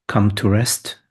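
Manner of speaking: normal talking voice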